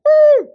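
loud, high whooping call like a finger whistle; whistle-like woo call. Retold or imitated. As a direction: let out a piercing high woo. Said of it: "and you go".

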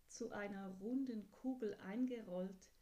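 ordinary delivery

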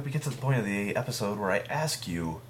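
usual speaking voice

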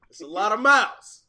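normal talking voice

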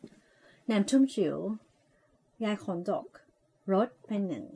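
slow, soft and mumbled like a cool VJ; soft slurred VJ read of the sausage slogan; lazy VJ voice. Shown as a demonstration. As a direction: slur the slogan like a laid-back radio VJ. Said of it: "ทำเสียงแบบที่เขาชอบแบบเป็น V เอา วีเจ".

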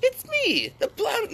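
very high cartoon clown voice; Joker voice. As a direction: squeal it like the Joker. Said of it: "Joker Mark Hamill impression".

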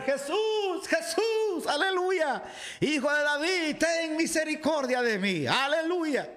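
high, pleading cry to Jesus; high pleading shout for mercy; blind man crying out. A narrator's reading. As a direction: call out desperately over the crowd. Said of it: "Entonces el hombre empezó a gritar".

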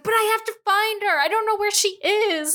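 high-pitched frantic protest; imitated panicky whine; mock panic. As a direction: plead in a panic, voice way up. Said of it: "he's, like, literally in a panic".

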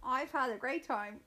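usual speaking voice

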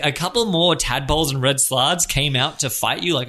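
ordinary talk

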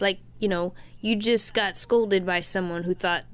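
ordinary talk, nothing marked

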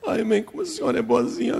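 put-on high childish whimper; squeaky tearful child, hurried; weepy little girl. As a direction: let grateful words melt into sobs. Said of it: "Não chora mais não".

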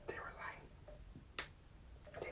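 breathy dramatic whisper. Acted. Revealing the fourth ticket was fake, in a hushed whisper.

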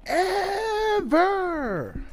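high cracking yell on ever; wrestling promo. Pretend. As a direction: scream it like a heel in the ring. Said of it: "So we are going to cut a promo".

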